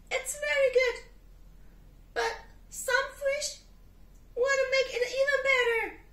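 high little penguin, rushing at the end. Acted. Penguin asking the cat for fish in the cake.

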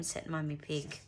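plain reading voice

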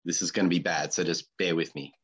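normal talking voice

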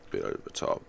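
low whispered repeat; whispered aside. Real, not acted. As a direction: whisper it to yourself. Muttered repeat of the line.

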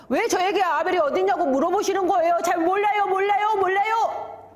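fast, high protesting rush; defiant denial. Acted. Cain denying to God he knows of Abel, fast indignant high outburst. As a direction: protest rapidly, pitch climbing and staying up.

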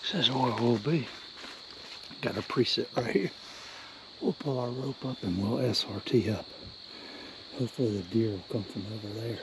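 hushed, quiet voice; whispering while hiding. Real, not acted. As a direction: keep it down so deer won't spook. Sneaking in near deer bedding.

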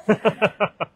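loud, high burst of laughter; bright laugh. Acted. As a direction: laugh out at the joke. Laugh after joke.

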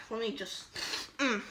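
ordinary talk, nothing marked

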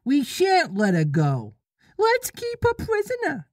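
lost boys pleading, high and hammed-up; crying lost boys. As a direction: squeak it out like panicky lost boys. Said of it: "they cried".

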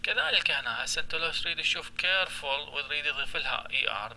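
ordinary talk, nothing marked

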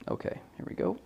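soft unvoiced whisper before the shot. Real, not acted. Photographer whispering to himself before taking the shot. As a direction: breathe it out under your breath.

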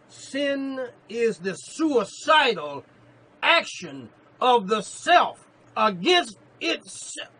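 very slow, loud, drawn-out preaching shout; slow repeated proclamation. Acted. Preacher repeating the quote for his beloved, slow loud shout. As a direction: stretch every word so it sinks in. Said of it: "Allow me to repeat that in your hearing".

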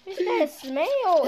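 loud, slow, high alarmed question; disgusted shock. Real, not acted. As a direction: recoil from a bad smell. Disgusted by the smell.

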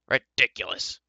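grumpy, indignant grumble; irritated dwarf grumble; muttered. A narrator's reading. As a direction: grumble it crossly to yourself. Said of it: "muttered Dolly".

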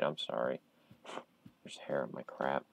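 mumbled aside. Real, not acted. Streamer mutters to himself about dog hair, quiet. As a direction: mutter it under your breath.

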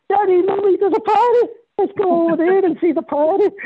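put-on high, loud child's voice calling out; excited child. Kids at the ship call to their parents, high and excited. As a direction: squeal it like a kid spotting a pirate. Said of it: "They go".

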